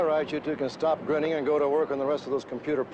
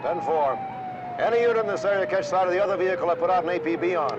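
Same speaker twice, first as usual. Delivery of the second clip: radio call; 6 semitones higher, 4 dB louder than usual. Acted. Radio call to all units.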